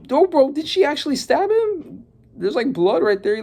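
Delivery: high, stunned bro did she; shocked disbelief. Real, not acted. Reacting to the stab panel, in high shocked disbelief. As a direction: voice jumps up in real shock.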